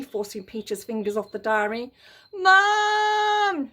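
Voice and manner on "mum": high, loud shriek. Acted. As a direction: shriek for Mum. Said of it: "shrieked Peter".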